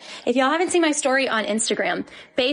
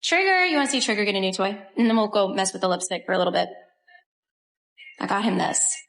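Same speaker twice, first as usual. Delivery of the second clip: ordinary talk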